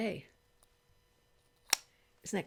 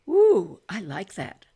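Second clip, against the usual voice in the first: delighted ooh; 7 dB louder, slower than usual. Real, not acted. Delighted by makeup result.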